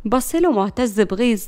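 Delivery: ordinary delivery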